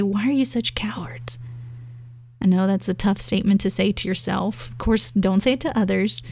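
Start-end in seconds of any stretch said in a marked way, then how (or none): 0.0-1.4 unvoiced whisper, soft and inward; a quiet whispered challenge to your own fear; whispered self-rebuke. Shown as a demonstration. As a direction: hush it to yourself like a private scolding. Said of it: "we can say".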